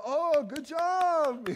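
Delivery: high, loud, drawn-out fake praise; exaggerated, stretched-out congratulations; mock cheering. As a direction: gush it like a fickle crowd. Mock crowd suddenly praising him.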